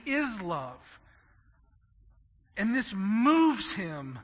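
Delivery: loud, rising preacher's climax; full-force preaching. Acted. Pastor to the congregation about Christ's love, preaching loud and fervent. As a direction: build it up and drive every phrase home.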